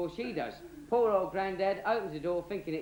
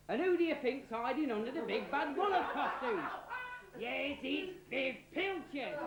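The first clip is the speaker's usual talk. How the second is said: laughing reveal; 8 semitones higher, 4 dB quieter than usual. Real, not acted.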